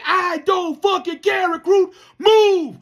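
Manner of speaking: very high, loud barked order; mock drill instructor shout. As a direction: bark the order at a crying recruit. Instructor yelling.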